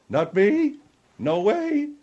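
emotional refusal with the pitch jumping high; voice cracking. Acted. Guest refusing to accept the host's view, voice cracking. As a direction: let the voice crack upward on each phrase. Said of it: "now I know why your voice cracks".

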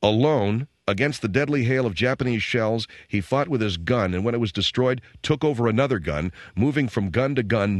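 measured, solemn reading of a medal citation; formal citation read. Acted. Host reads a hero's citation aloud, measured and formal. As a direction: read it like an official commendation.

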